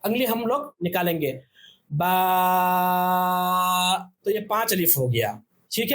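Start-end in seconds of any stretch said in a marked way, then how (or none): 1.9-4.1 the vowel of बा held very long, five counts; five-alif stretch. Shown as a demonstration. Teacher stretching a vowel for students, long drawn बा. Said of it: "अगर ज़्यादा खींचेंगे तो जितने अलिफ".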